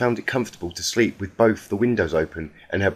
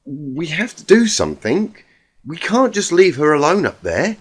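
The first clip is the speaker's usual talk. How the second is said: higher, pressing voice of a worried friend; voiced pony friend, pleading; 7 semitones higher, 4 dB louder than usual. A narrator's reading. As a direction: urge them not to leave her.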